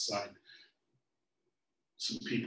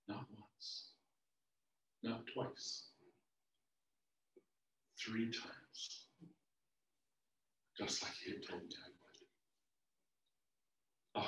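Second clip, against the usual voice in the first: stunned, near-whispered; 13 dB quieter than usual. Acted. Peter realizes his betrayal.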